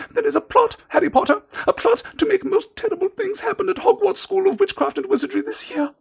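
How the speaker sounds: very high elf voice, urgent and secretive; squeaky elf, hushed. A narrator's reading. Dobby warns Harry of a plot, high urgent elf squeak. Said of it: "whispered Dobby".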